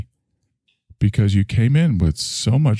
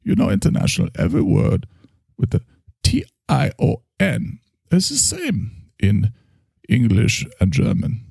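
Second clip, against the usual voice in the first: German doctor voice, higher; German accent; 10 semitones higher than usual. Acted. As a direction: Freudian German therapist accent. German therapist character.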